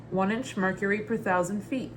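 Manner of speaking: ordinary talk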